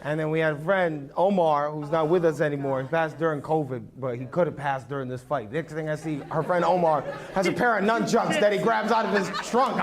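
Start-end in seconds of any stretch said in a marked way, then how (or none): none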